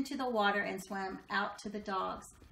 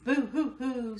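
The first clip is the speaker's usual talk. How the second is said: mock sobbing; 3 semitones higher, 4 dB louder than usual. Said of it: "sobbed the dogs".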